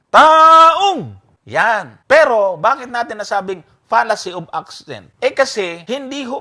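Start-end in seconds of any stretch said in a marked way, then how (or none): 0.1-1.2 "taong" shouted high and drawn out; stress on taong. Shown as a demonstration. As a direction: blast out the stressed word "taong". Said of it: "So, nasaan yung accent?". (pasigaw at pahabang diin sa "taong")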